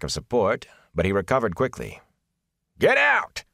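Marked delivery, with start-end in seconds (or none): 2.8-3.4 high, loud furious shout; furious shout at the Aes Sedai. A narrator's reading. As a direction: hurl it at her like a threat. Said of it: "he shouted again".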